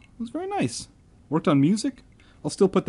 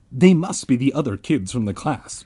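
usual speaking voice

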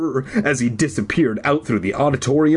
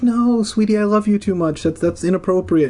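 higher, gentle mock-tender turn-down; gently refusing; 9 semitones higher than usual. Owner gently turning down the cat, mock tender. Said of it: "And I'm like".

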